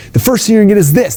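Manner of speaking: high, excited sales-pitch parody; hyped pitchman. Retold or imitated. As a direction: parody an over-eager stage seller. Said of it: "they're like".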